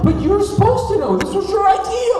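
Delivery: high-pitched mock panicked yell; indignant outburst. Kid blaming his runaway friend, indignant outburst. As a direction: wail the accusation in hammed-up outrage.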